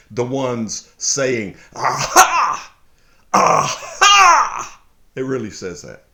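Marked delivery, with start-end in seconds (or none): 1.7-4.8 high, loud taunting "Aha! Aha!"; mocking gloat. Preacher voicing the enemies' taunt, high and loud. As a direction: jeer "Aha" like a gloating enemy. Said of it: "Emphasis added on my part".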